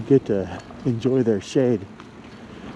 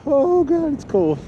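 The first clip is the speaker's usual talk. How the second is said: gasping in icy wind; 12 semitones higher than usual. Real, not acted. Riding into icy gusts.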